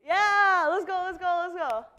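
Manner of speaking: loud hype cheer. Real, not acted. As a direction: pump up the room, loud. Cheering start of contest.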